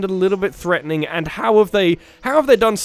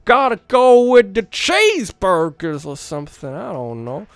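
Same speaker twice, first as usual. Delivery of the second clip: broad, hammed-up American accent; put-on American accent; 5 semitones higher, 3 dB louder than usual. Shown as a demonstration. British gamer trying out voices for villains, American accent. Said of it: "Gotta go the American accent".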